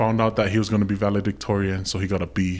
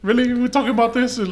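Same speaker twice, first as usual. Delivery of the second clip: high-pitched mock disbelief; high, dismissive mimicking question; mock incredulous; 13 semitones higher than usual. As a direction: imitate someone put off by the topic, pitched way up. Mimics bored people rejecting topics.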